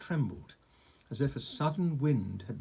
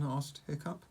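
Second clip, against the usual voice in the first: ordinary delivery, nothing marked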